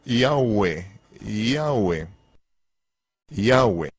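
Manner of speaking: "Yahweh" said as ya-oo-EH, drawn out and repeated; demostración de "Yahweh"; pronunciation demo. Shown as a demonstration. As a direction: model the name "Yahweh" for the class three times. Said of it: "Se pronuncia".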